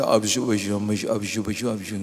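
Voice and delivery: put-on soft babbling child talk, fading away; baby talk prayer. Shown as a demonstration. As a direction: babble like a little kid praying. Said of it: "Doa dengan bahasanya dia".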